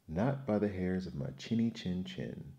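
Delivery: ordinary delivery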